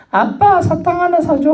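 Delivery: put-on high sweet child voice asking dad for candy; little child begging. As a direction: beg like a four-year-old at the shop, pitched high. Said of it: "네 살, 다섯 살 애들이 와서".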